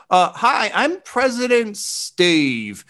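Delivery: very high, stretched-out character voice; President Stave. Acted. Former president introducing himself over the loudspeaker, high stretched voice. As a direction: introduce yourself high and drawn out. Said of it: "Over the loudspeaker, you hear".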